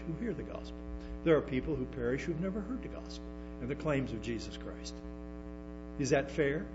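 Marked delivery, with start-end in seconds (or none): none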